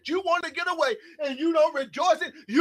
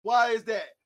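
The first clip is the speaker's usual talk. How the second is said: ordinary talk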